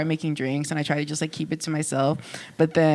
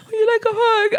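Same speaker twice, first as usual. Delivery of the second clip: tearful retelling; 4 dB louder than usual. Real, not acted. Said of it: "She was like".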